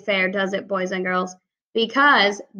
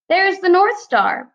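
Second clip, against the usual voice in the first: excited exclaim; 9 semitones higher, 4 dB louder than usual. Acted. Character exclaims in read-aloud.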